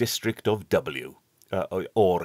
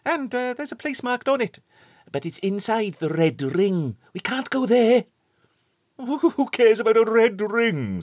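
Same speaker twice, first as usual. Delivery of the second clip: put-on high, quick boyish chatter; schoolboy voices; 5 dB louder than usual. A narrator's reading. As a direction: lift the voice up as eager schoolboys. Wilfred and Stanley arguing.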